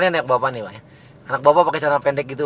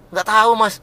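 high, loud sulky reply; sullen brush-off; 8 semitones higher, 5 dB louder than usual. Retold or imitated. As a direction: snap back like a put-out passer-by. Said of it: "Langsung cemberut".

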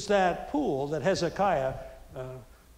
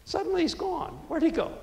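much higher, mock bewildered; puzzled onlookers; 10 semitones higher than usual. Crowd losing Jesus, mock surprised and much higher. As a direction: play the baffled crowd looking around.

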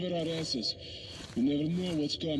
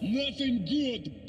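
frustrated; 10 semitones higher, much slower than usual. Acted. Frustrated reply to a question.

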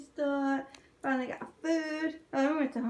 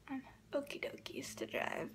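whisper; 14 dB quieter than usual. Real, not acted. Playful aside about driving safely.

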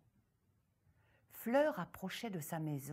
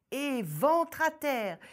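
usual speaking voice

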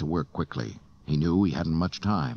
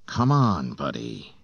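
appeal; 3 semitones lower than usual. A narrator's reading. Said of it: "he appealed".

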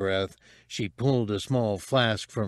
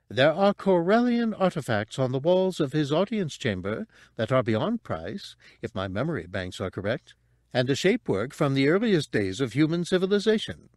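higher, precise droid voice; fussy droid; 5 semitones higher than usual. Acted. Droid tells thieves of the treasures, fussy recital. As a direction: recite like an obliging droid guide.

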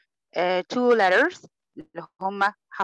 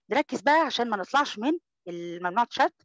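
ordinary talk, nothing marked

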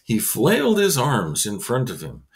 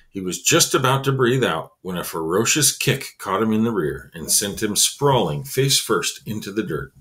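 usual speaking voice

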